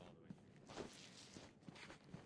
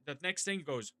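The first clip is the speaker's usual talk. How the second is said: ordinary talk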